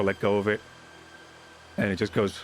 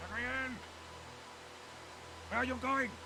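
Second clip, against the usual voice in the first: calling out; 14 dB quieter than usual. Acted. Calling after wandering friend.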